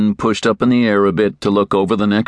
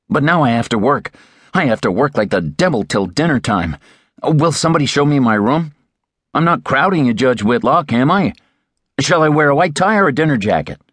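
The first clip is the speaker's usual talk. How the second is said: put-on higher, eager young man's voice; eager young Terry; 8 semitones higher than usual. A narrator's reading. Young Terry quizzing the master, higher and eager. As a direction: press the question, young and keen.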